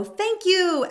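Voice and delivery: bright, high, excited thank-you; exclaiming. Shown as a demonstration. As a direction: say it like an exclamation mark. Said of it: "exclamation point".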